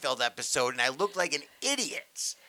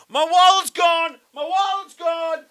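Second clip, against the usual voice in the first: very high, hammed-up sitcom yell; high mock-panicked screaming; imitated screaming; 13 semitones higher, 7 dB louder than usual. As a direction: shriek it like a robbed sitcom dad. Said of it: "he starts screaming".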